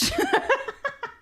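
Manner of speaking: high giggling laugh. Real, not acted. Streamer amused by his fish catchphrase, high laugh.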